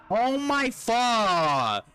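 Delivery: slow, drawn-out hammed-up shriek; mock scream. Streamer previewing his scream if caught, hammed-up. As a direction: stretch it out like a fake scream. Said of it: "I'm gonna be like".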